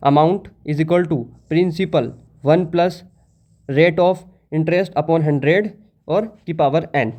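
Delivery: very slow, each term spaced out; slow dictation again. Shown as a demonstration. Teacher repeating the formula for students to copy, very slow. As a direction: repeat the formula slowly so everyone writes it. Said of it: "formula क्या होता है".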